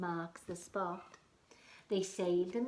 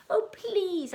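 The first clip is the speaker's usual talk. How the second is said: pleading spider; 12 semitones higher, 11 dB louder than usual. Acted. Spider begs again.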